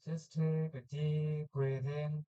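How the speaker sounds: slow, calm meditation voice; guided meditation. Acted. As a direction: guide the breath gently and slowly.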